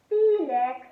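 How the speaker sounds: loud, sharp call of his name; yelled. A narrator's reading. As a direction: yell it across the kitchen. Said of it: "yelled his mum".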